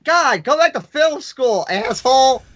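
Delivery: high, cracked heckler voice, hammed up; cranky old-man heckler. Critic telling off a basic filmmaker, high mock-cranky rant. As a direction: heckle like a crotchety old puppet. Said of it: "two old men from Sesame Street".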